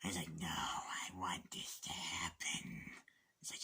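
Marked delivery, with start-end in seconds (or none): 0.4-2.9 slow breathy menace, whispered; creepy slow whisper. Retold or imitated. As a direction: hush it slowly, like a predator. Acting out Dafoe character.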